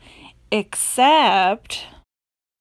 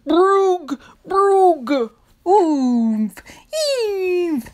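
silly sounds. Shown as a demonstration. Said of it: "make silly sounds like".

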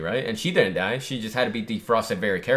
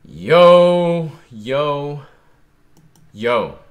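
much louder, higher excited yell; hyped shout; 4 semitones higher, 10 dB louder, much slower than usual. Real, not acted. Fan yelling in hype at the big reveal, loud shout. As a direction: shout it out, pumped.

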